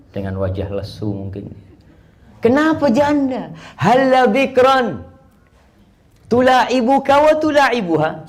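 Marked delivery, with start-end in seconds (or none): 2.4-8.3 high, loud, slow playful questioning; voiced Prophet teasing. Preacher voicing the Prophet asking Jabir, high and playful. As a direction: tease him gently about his bride.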